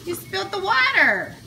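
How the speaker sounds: firm but sing-song parent tone. Real, not acted. Mom scolding toddler at dinner.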